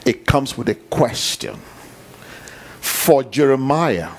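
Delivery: normal talking voice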